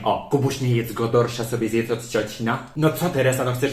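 normal talking voice

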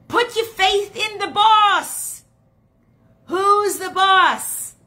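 slow, loud, high rallying cry; emphatic preaching climax. Real, not acted. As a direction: call it out to the whole congregation. Preacher's climax, calling out to audience.

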